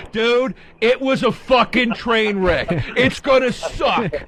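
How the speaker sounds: drawn-out, high-pitched whiny caller parody; mock typical caller. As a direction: stretch it out, mimicking callers' overblown complaints. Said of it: "That's all anybody ever calls in and says".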